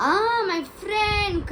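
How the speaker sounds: high, drawn-out exclamation; crying out. Retold or imitated. Detective exclaiming to his friend, crying out slowly. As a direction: stretch it out as a dramatic cry. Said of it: "cried Pirate".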